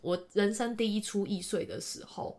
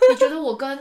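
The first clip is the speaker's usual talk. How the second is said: laughing; 6 semitones higher, 8 dB louder than usual. Retold or imitated. Retelling own accusation while laughing.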